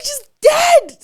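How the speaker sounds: very high, very loud shriek; panicked scream. Retold or imitated. Mother telling family her baby seems dead, panicked scream. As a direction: scream it out in horror. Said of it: "So I'm screaming".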